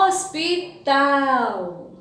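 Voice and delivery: hospital slow, syllable by syllable, long final tal; strong syllable aloud. Shown as a demonstration. Teacher showing pupils the strong syllable, hospital with stretched tal. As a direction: draw out hospital, landing on tal. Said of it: "Tal é a sílaba mais forte".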